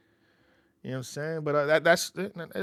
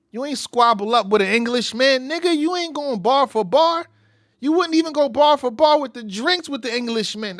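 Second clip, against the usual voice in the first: taunting; 8 semitones higher, 9 dB louder than usual. Retold or imitated. Voicing [name]'s taunt.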